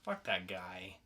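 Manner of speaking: ordinary talk, nothing marked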